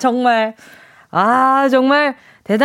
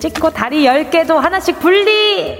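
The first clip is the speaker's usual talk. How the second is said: dramatic narration; 2 semitones lower than usual. Acted. Dramatic sound-quiz narration.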